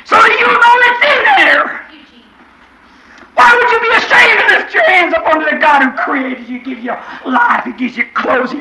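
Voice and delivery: full-force preaching. Acted. Preacher crying out to the congregation about riches. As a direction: lift them up, crying it out.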